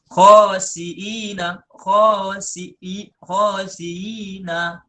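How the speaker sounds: khosiina drawn out slowly; slow recitation. Shown as a demonstration. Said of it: "seperti itulah". (khosiina dibaca panjang dan pelan)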